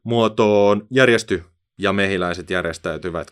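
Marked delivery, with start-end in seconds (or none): 0.0-1.4 loud, sharp order; stern command. A narrator's reading. Bee foreman orders the swarm into formation. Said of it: "huudahti tiukkaan sävyyn".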